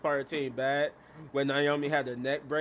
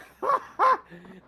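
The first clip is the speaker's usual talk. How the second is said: high-pitched loud cackle; shrieking tickled laugh; amused laugh; 14 semitones higher, 6 dB louder than usual. Real, not acted. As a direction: burst out laughing at the insult. Amused by the insult.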